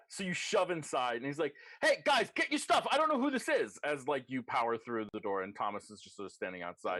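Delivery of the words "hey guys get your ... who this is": high, loud alarm call; panicked warning shout. Acted. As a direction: yell a warning to your friends. Doorman calls warning to his friends.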